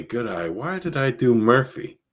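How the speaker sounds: ordinary talk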